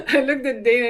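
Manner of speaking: telling it through real laughter; laughing. Real, not acted. Retelling an awkward moment with Dana, laughing.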